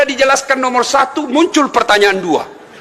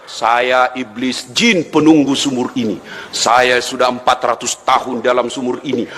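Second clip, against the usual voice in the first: well genie; 9 semitones lower than usual. Acted. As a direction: rumble it out like an ancient demon of the well. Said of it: "siapa kamu". (suara jin iblis, rendah dan pelan)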